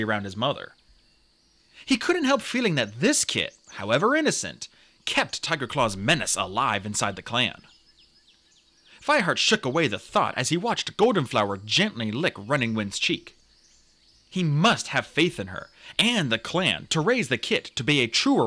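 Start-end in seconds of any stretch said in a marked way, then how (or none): none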